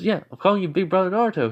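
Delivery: put-on high, fast kid's reply; insistent kid voice. Boy confirms his nickname for Naruto, insistent.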